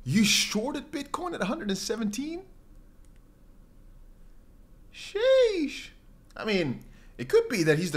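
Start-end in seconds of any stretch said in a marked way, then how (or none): 0.0-2.5 slow, high disbelieving question; real incredulity, pitched up; incredulous. Real, not acted. Reacts to a viewer's risky trade. 4.9-5.9 very high, squeaky exclamation; long falsetto "sheesh"; amazed reaction. Real, not acted. Reacts to a viewer's risky trade.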